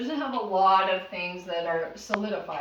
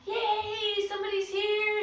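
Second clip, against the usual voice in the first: much higher, gleeful puppy voice; excited puppy; 11 semitones higher, slower than usual. Pretend. Voicing the dog greeting guests, high and gleeful. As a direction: squeal like a thrilled dog. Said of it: "she's like".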